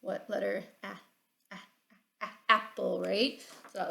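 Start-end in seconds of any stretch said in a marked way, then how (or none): 0.8-2.3 Ah repeated as a short a sound, soft. Shown as a demonstration. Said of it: "we're gonna change it to ah".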